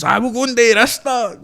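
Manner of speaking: high, loud mocking of grand plans; mocking quote. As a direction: sneer at the big promises in a high voice.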